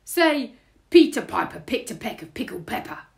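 loud burglar prompt, starting high; booming burglar tongue-twister; burglar character. A narrator's reading. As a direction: challenge the baby loudly. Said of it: "said Burglar Bill".

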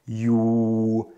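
"Ju" set on the low tone, held level; teacher repeats "Ju" on the low tone; low tone Thai. Shown as a demonstration. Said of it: "also tief".

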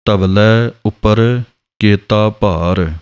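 ਉੱਪਰ with a short u inside a slow reading; slow careful recital. Shown as a demonstration. Teacher to students, reading the line slowly with a short ਉੱਪਰ. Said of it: "ਔਂਕੜ ਦੇ ਨਾਲ ਹੀ ਬੋਲਣਾ ਹੈ".

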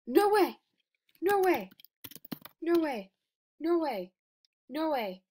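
loud high disbelieving screams, fading each time; shocked shouts. Real, not acted. Player sees the rare item drop, shocked shouts. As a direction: yell it in pure disbelief, then wind down.

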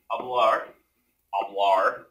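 hablar said with an American accent, flat English r; gringo accent. Shown as a demonstration. Teacher says hablar in a gringo accent, self-mocking. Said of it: "I say that all gringo".

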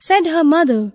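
plain reading voice

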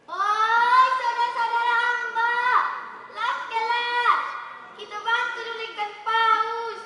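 high, loud call to others; rally everyone loudly to help. Acted. Calling siblings to help.

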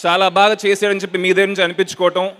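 normal talking voice

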